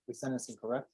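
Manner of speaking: normal talking voice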